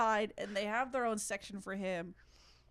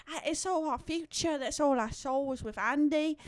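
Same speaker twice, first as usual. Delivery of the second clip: higher mock British mother; imitated British mom; 4 semitones higher than usual. Imitating the mom talking of Andy, high British voice. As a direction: play the mum, voice lifted, British accent. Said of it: "they cut to the mom".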